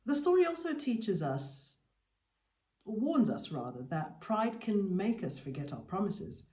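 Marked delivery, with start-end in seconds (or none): none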